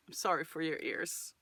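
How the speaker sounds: ordinary talk, nothing marked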